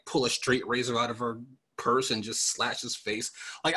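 ordinary talk

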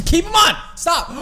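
high, loud yell of protest; exasperated high-pitched holler; urgent shout. Real, not acted. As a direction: shout to stop them leaving. Yelling at co-host about to leave.

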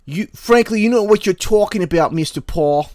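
put-on high, loud Brooklyn accent; Doctor Fauci. Fauci answering Doctor Paul, Brooklyn-accent impression. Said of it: "I hate that accent. That Brooklyn".

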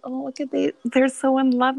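high, gushing coo over a couple. Retold or imitated. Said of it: "And we're like".

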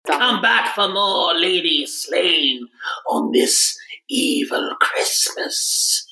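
slow spooky host voice, trailing into a whisper; creepy Halloween sign-off, drawn out slow; jack o lantern voice. Acted. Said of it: "that was really good".

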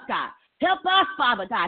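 ordinary delivery

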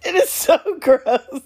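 very high, breaking into laugh-sobs; fighting real hysterical laughter; helpless laugh-crying apology; weeping with laughter. Real, not acted. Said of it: "I am seriously sitting here weeping".

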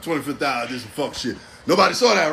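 usual speaking voice

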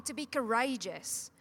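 normal talking voice

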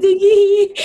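high, breaking into laughter; laughing. Real, not acted. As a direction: laugh through the words. Riso incontrolável no fim. (rindo sem parar)

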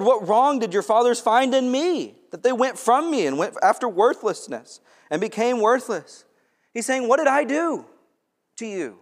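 loud, high preaching voice pleading for the people to remember; full-force preaching. Acted. Preacher to congregation, urging them to remember: loud preaching. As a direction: preach it out, building to a cry. Preaching God's plea to Israel.

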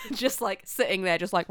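usual speaking voice

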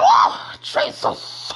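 high cry then breathy whisper of Jesus; emotional relieved gasp; overwhelmed relief. Retold or imitated. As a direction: burst out, then fade to a breath. Relieved reaction to dad.

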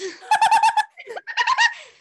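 high, loud shrieking laugh; real shriek of laughter. Real, not acted. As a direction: burst into a piercing laugh. Big laugh at joke.

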